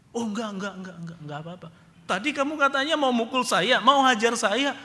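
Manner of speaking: high, nervous denials; scared backpedaling. Retold or imitated. Father backpedals nervously in front of the soldier. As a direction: squirm out of it fast. Frightened father denies to big man.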